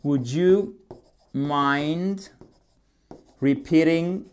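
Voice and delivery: measured model with a pause before 'mind' and 'repeating'; feed it to learners piece by piece; careful word-group delivery; chunked dictation. Shown as a demonstration. Teacher dictates phrase in chunks.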